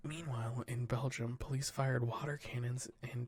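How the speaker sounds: steady newsreader delivery; news read. Acted. Podcast host reading the headlines to cohosts, newsreader style. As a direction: read the wire report to the cohosts, measured news voice. Reads news stories aloud.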